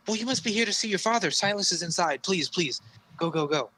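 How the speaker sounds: a hint of a keen young knight hurrying him inside; eager paladin. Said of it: "he's like".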